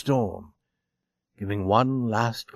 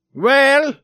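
loud, much higher challenge; 13 semitones higher, 8 dB louder than usual. A narrator's reading. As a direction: call it out brashly. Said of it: "he said loudly to Scully".

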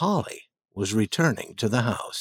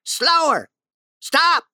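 high, loud captain's commands; shouted orders; 4 dB louder, slower than usual. Acted. Ben as captain orders the boat to stop, loud. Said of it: "he shouted".

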